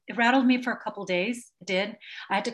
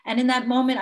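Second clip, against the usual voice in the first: normal talking voice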